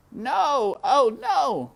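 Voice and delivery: high, loud anguished cry; dismayed cry. A narrator's reading. Despero sees the empty armor, crying out high and loud. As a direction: cry out in despair. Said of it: "said Despero".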